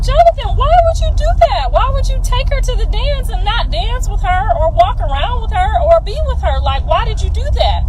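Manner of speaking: high-pitched, exasperated questioning; retold motherly scolding. Mom retelling how she confronted her son about the dance, scolding. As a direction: take a son to task, voice way up.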